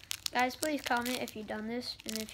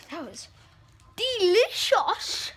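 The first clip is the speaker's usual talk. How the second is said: sarcastic; 7 semitones higher, 6 dB louder, slower than usual. Pretend. Fake praise of sour candy.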